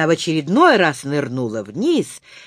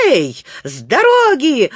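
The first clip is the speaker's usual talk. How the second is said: loud, raised warning call; urgent higher-pitched cry; desperate shout; 4 semitones higher, 5 dB louder than usual. A narrator's reading. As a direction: yell to clear the way. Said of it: "отчаянно закричал малыш".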